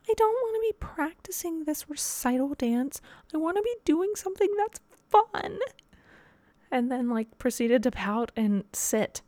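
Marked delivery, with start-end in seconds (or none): 0.1-5.6 put-on high whiny child voice, much higher; whiny little girl. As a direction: imitate a sulking four-year-old, pitched way up. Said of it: "She goes".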